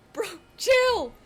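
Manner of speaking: high, loud yell at a bee; high-pitched yelled protest; exasperated shout. Retold or imitated. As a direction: plead loudly for it to back off.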